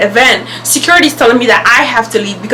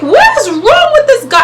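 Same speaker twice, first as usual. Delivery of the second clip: very high-pitched disbelief; incredulous; 14 semitones higher than usual. Retold or imitated. Storyteller reacts to the man insulting her, incredulous. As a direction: exclaim it in indignant amazement. Reacting to being called names.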